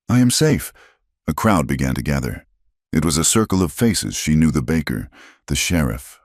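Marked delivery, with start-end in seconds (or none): 0.1-0.7 high, gentle reassurance; comforting. A narrator's reading. Eliza comforting her brother.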